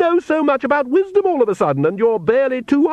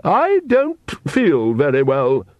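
slow, higher, woozy Countess; lightly acted queasy faintness, drawn out; unwell; 4 semitones higher, slower than usual. A narrator's reading. Countess feeling suddenly sick, swaying and woozy. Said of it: "said the Countess, swaying slightly".

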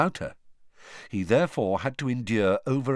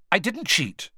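sharp retort; 8 semitones higher than usual. Acted. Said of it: "said Harry sharply".

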